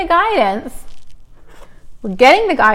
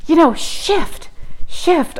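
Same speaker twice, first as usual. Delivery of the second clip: imitating mom; 6 semitones higher, slower than usual. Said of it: "She's like".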